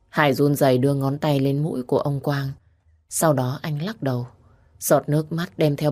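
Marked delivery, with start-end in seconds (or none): none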